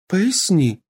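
high, insistent demand. Acted. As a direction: demand an answer. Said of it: "потребовала она".

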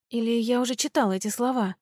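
usual speaking voice

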